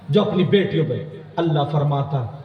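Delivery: ordinary delivery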